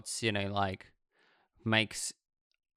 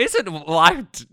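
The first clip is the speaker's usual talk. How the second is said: high, slow, sugary housewife voice; cheery 1950s housewife gushing; 9 semitones higher, 10 dB louder, slower than usual. Retold or imitated. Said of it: "sound like a nineteen fifties housewife".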